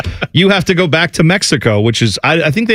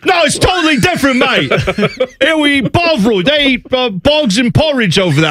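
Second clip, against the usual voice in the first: put-on English accent; 11 semitones higher than usual. Hooligan scoffing at the rival town in a mock British accent. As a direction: rattle off nonsense like a Brit. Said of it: "It's not a bad English accent".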